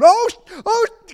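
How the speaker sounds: high, loud startled half-swear; falling backward exclaiming. Retold or imitated. Man falling backward in church, yelping high and loud. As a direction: yelp it out as he topples. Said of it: "he's falling backward going".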